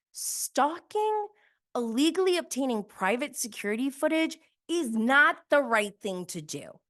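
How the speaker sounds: high indignant emphasis; emphatic outrage. Real, not acted. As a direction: raise the voice in disbelief. Heated rant against Monica.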